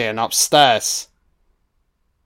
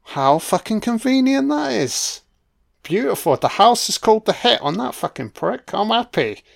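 much higher, delighted crowing; gleeful gloating; 7 semitones higher than usual. Real, not acted. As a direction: savour the villain's downfall. Gloating over villain's death.

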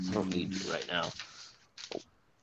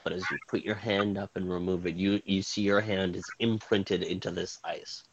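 dramatic scene-setting narration; game master narration; 3 semitones lower, 4 dB louder than usual. Acted. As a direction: set the scene like a storyteller. GM narrates the ice cave.

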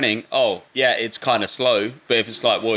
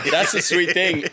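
said through a laugh; 7 semitones higher than usual. Real, not acted. Laughing while talking.

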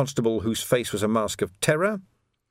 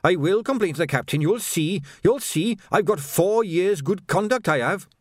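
high, whining protest; aggrieved corporal's high outburst; indignant corporal; 9 semitones higher than usual. A narrator's reading. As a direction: protest your sacking, pitch climbing. Quirk protests his dismissal.

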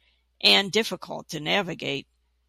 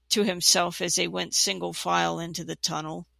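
ordinary delivery

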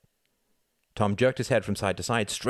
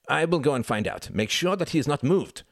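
higher, firm urgency; urgent orders; 8 semitones higher, 4 dB louder than usual. A narrator's reading. Klaus leaving to find a hospital, urgent. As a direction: give the instruction urgently, pitched up.

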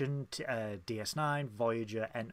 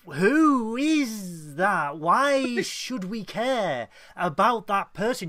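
high, louder, slow baffled protest; mock bewilderment, stretching each word; exasperated viewer; 7 semitones higher, 8 dB louder, much slower than usual. Viewer baffled by the show's drama, mock exasperated. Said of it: "And you're like".

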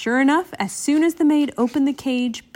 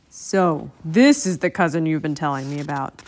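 low, measured crocodile; deep character voice for a crocodile; big crocodile voice; 5 semitones lower than usual. A narrator's reading.

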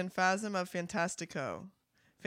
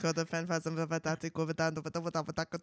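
rapid tongue twister; 2 semitones lower, much faster than usual. Shown as a demonstration. Host dared to say it five times, rapid garbled tongue twister. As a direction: rattle it off fast, words collapsing. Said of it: "Say that five times fast".

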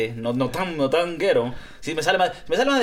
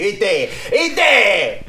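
mock Argentine; 4 semitones higher, 5 dB louder than usual.

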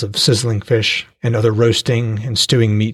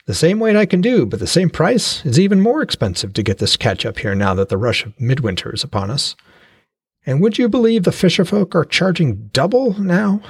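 a hint of a proud fishmonger, slightly higher; lightly voiced trader; 7 semitones higher than usual. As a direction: praise your catch like a cheerful trader. Trader Uni voiced in story.

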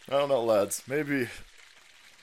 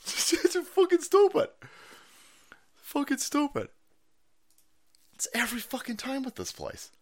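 high exasperated gripe; 11 semitones higher than usual. Real, not acted. Griping about broken platform.

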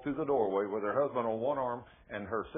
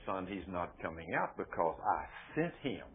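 low, soft, lightly acted reply; voice of the Lord; 6 semitones lower, 6 dB quieter than usual. God explaining to the preacher, low quiet voice. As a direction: answer quietly from above, pitched down. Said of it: "And the Lord says".

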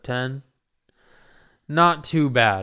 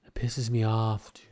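whisper; 5 dB quieter than usual. Real, not acted. Raging at a noise, whispering.

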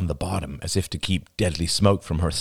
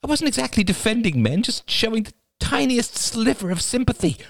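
voiced higher as Rachel, hurt and incredulous; a baffled young woman protesting to herself; Rachel's inner voice. A narrator's reading. Rachel defending herself in her head, pitched up and incredulous. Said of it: "she wondered".

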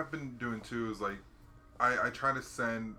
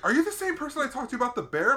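much higher, fast eager question; excited caller; 13 semitones higher, 8 dB louder, faster than usual. Retold or imitated. Returning caller checks the worker, fast and high. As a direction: rush it out excitedly. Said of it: "he's like".

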